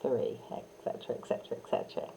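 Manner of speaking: soft whispered count trailing off; just breathe the count away. Shown as a demonstration. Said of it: "counting demo".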